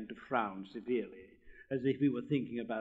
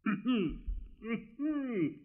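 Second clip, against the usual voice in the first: pompous throat-clearing cough; 11 semitones higher, 3 dB louder than usual. Acted. Rocket coughing to show off his voice, pompous throat-clear. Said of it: "and he began to cough".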